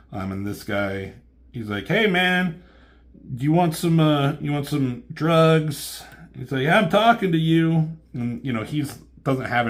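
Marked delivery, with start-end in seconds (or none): 1.9-6.0 higher and louder, slow sleazy pitch to a passerby; hammed-up dealer hustling a customer; street dealer voice. Voicing a street drug dealer. 6.6-8.0 hammed-up dealer hustling a customer; street dealer voice. Dealer pushing the hero.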